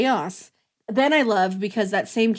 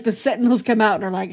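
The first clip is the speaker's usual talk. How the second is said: usual speaking voice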